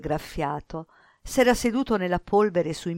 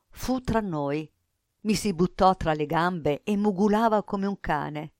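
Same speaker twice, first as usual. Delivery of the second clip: usual speaking voice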